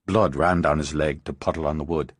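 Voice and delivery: plain reading voice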